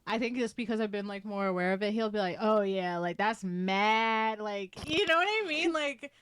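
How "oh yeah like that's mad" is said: "mad" stretched long and slow; drawn out vowels. Retold or imitated. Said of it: "really enunciate, like, vowels".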